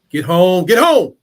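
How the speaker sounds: loud, building shout at the player; excited sideline yell; urging shout. Real, not acted. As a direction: holler it at the game, rising on the repeat. Calling out at game play.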